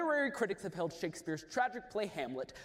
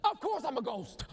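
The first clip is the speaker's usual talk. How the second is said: a lightly voiced ghost, higher and amused; 5 semitones higher than usual. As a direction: play the ghost with a chuckle. Ghost answers Hamlet.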